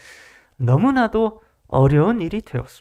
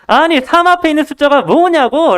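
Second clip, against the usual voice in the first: higher, insistent mock-teacher questioning; incredulous repeated question; 7 semitones higher, 3 dB louder than usual. Tutor asks the pupil the question once more, incredulous. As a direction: press the question in disbelief, pitched up. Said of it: "굉장히 이상한 사람을 본 것처럼".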